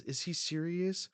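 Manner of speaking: mildly acted panic; alarmed disbelief. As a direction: ask it like a startled runner. Voicing panicked runners in manga.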